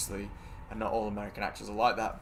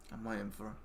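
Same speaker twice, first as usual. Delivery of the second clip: normal talking voice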